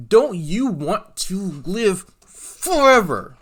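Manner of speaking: high, loud, very drawn out, falling on "forever"; booming mock preacher. Streamer pressing folks about salvation, hammed-up booming preacher. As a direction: stretch every word, belt out "forever".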